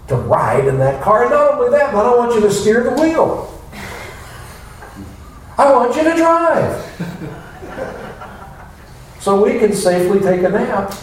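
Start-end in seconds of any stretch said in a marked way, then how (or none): 1.2-10.9 much higher, rising, cheeky demands; mock demanding prayer. Preacher mimicking a selfish worshipper, high and demanding. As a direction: pitch it up like ordering God around.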